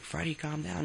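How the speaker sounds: put-on high-pitched mom telling off her kid; imitated mother. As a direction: voice a mother reining in her son. Voicing the mother soothing him.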